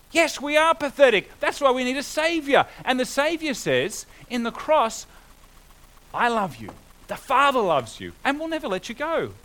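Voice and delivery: rising preacher's fervour; preaching at full force. Acted. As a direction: proclaim it to the congregation.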